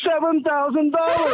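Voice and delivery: high, excited shout of the total. Real, not acted. Caller telling the host his free silver total, excited shout. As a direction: shout the number in amazement.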